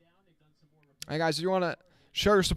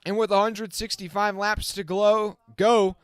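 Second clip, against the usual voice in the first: brisk, raised race-calling voice, a touch higher; live play-by-play; 4 semitones higher than usual. Acted. Streamer calling the race for his viewers, lively play-by-play. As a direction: call the restart like the race is on the line.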